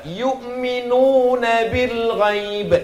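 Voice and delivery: slow "Yu'minuna" with long u; stretched recitation of "bil ghaib"; long vowel demo. Shown as a demonstration. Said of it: "ada panjang ke depan".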